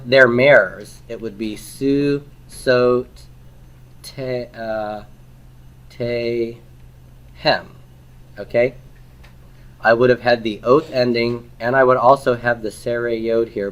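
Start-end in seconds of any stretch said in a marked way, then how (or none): 1.6-7.8 su, sot, te, hem, each syllable separate. Shown as a demonstration. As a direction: build "su ... sot ... te ... hem" with long pauses. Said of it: "if I wanted to say their".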